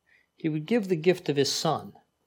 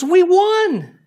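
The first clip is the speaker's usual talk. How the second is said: high, loud gleeful cheer; mock victory cheer; 9 dB louder than usual. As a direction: cheer like a fan whose team just won. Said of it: "And my wife goes".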